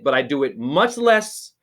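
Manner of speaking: ordinary delivery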